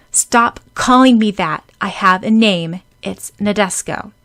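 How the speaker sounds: put-on indignant girl. A narrator's reading. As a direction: put your foot down, insulted. Nadeshko annoyed at the nickname.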